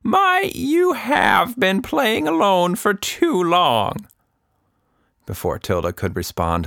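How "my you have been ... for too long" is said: put-on high, slow old woman's voice; an old lady gently mocking; old Anita teasing. A narrator's reading. Anita teasing Tilda for playing alone, in a high, slow old woman's voice. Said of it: "Anita wiped a tear".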